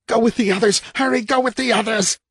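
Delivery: urgent fearful voice. A narrator's reading. Urgent inner voice.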